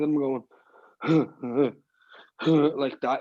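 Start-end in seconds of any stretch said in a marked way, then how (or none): 1.0-1.7 short breathy laugh sound; laugh demo. Shown as a demonstration. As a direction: show the choked laugh between lines. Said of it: "you can hear me doing this laugh".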